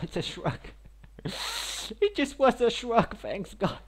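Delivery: laughing through the words. Real, not acted. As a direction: giggle while saying it. Laughing at the reward.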